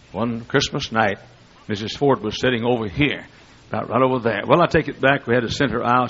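normal talking voice

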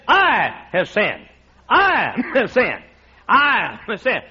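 slow, drawn-out I have sinned; slow confession. Pretend. Pastor confessing to his church, slow and heavy. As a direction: let each I have sinned sink in.

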